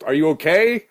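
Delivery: loud, high call to someone falling past; yelled question. Acted. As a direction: yell it across a distance. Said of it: "you yell".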